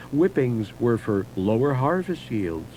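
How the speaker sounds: usual speaking voice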